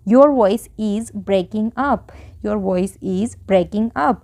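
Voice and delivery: very slow, word by word; slow model sentence. Shown as a demonstration. Teacher modelling an English sentence for Hindi learners, very slow. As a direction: say it slowly so learners can follow. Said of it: "इसलिए हमने यहाँ पे is का use किया".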